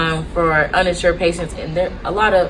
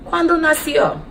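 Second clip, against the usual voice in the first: higher-pitched teaching voice; language demo; 9 semitones higher than usual. Shown as a demonstration. Nurse teaching how to ask when someone was born, higher demo. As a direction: present the Spanish question clearly, pitched up. Teacher repeats phrase for learners.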